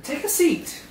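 high teasing offer. Real, not acted. Joking as seat collapses.

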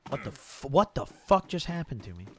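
ordinary talk, nothing marked

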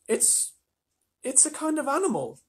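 put-on high, hesitant girl; Susan's girlish voice. A narrator's reading. Susan answers Lucy about the creature, hesitant high voice.

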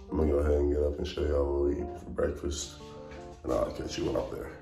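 usual speaking voice

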